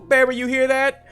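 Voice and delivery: high, loud gleeful holler; acted excited chimp; excited calling out. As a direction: crow to a pal in triumph. Excited chimp calls to his friend.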